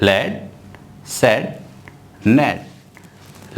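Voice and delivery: "A jet is wet" word by word, very slowly; very slow reading. Shown as a demonstration. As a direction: read it at dictation pace. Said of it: "मैं किस तरह से read कर रहा हूँ". (बहुत धीरे "A jet is wet" एक-एक शब्द)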